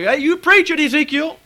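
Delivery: high, loud cheer for the preacher; excited shout. Acted. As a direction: shout it out like an eager congregation. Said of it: "jump around and shout and say".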